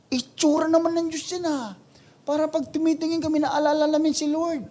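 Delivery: high, bright-pitched mock excuse; idol maker. Preacher mocking someone defending an idol, high pitched.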